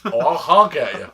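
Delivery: loud, slow joking threat; mock road rage. Warning drivers who cut him off, mock road-rage shout. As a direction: bellow it like a driver losing it.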